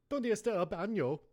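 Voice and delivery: Spanish with a German accent. Shown as a demonstration. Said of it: "I said it with a German accent".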